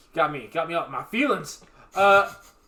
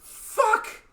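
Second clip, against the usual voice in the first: exasperated squeal. Acted. Mock outrage at no Fire Emblem.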